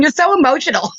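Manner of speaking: laughing quote. Retold or imitated. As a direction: mimic the husband's teasing line while giggling. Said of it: "my man's always saying".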